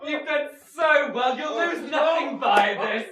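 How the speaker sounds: high, loud laughing shout; gloating laugh. Acted. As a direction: crow over him, laughing. Mocking victor gloats loudly.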